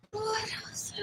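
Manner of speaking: soft unvoiced whisper. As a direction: breathe it out as a whisper. Whispered repeat.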